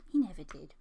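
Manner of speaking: unvoiced whisper aside. Real, not acted. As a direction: whisper it conspiratorially. Aside about naughty budgie.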